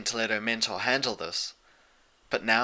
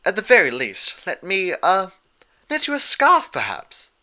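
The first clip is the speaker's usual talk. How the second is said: higher, hesitant genteel offer; lightly voiced refined lady; 9 semitones higher, 6 dB louder than usual. A narrator's reading. Rarity awkwardly offering a scarf, higher and refined. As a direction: fluster a little over the scarf.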